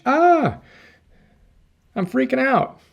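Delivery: high panicky yelp; mock panic. Test subject panicking about an impromptu speech, high and mock-frantic. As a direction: act out someone freaking out before a speech. Stressed person before speech.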